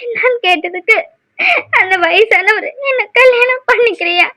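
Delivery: halting account between sobs; sobbing. Acted. Aishu reports the old man's insult to elder sister, sobbing. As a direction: tell it brokenly, crying throughout. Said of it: "அழுதுட்டு வந்திருக்க".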